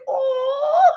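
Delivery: laugh-cry. Real, not acted. Moved by daughter's story.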